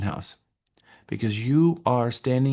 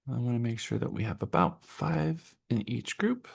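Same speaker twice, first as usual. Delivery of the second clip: whispered to herself while counting; quietly counting under her breath; a soft whisper aside; 7 dB quieter than usual. Real, not acted. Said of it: "whisper while counting groups".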